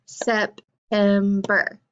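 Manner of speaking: slow, split into syllables; sep-tem-ber, one clap per part, slow; clapped syllables. Shown as a demonstration. Teacher clapping out syllables for the class, slow and split. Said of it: "Let's try it together now".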